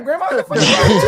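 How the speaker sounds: laughing in disbelief; cracking up mid-question; half-laughing at grandma. Real, not acted.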